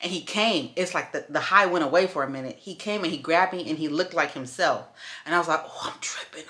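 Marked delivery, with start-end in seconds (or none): 5.6-6.4 soft whisper to herself; whispered realization. Retold or imitated. Coming down from the high, whispered to herself. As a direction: breathe it out under your breath.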